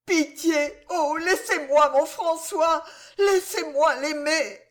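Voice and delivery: high, desperate pleading wife; begging young wife. Acted. Jeanne begging the constable to spare François: high desperate plea. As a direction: throw yourself on his mercy.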